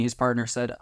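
ordinary delivery

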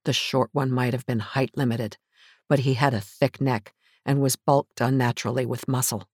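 ordinary delivery, nothing marked

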